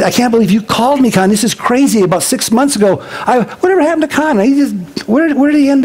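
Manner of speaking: put-on high, eager retelling of an old friend; excited friend. Friend on the phone wondering about Con, thrilled and high. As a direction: let the pitch jump with disbelief and glee.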